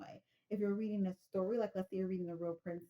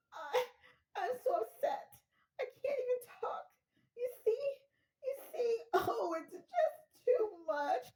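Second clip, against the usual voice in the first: much higher, slow, half laughing half sobbing; hysterical caller; slower than usual. Acted. Caller to the operator, high and laugh-sobbing in mock distress. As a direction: fall apart on the phone, laughing through mock tears.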